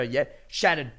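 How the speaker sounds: normal talking voice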